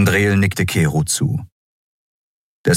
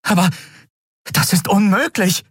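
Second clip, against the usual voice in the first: high, disbelieving cry; agitated objection; exclaiming. A narrator's reading. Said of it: "rief sie aus".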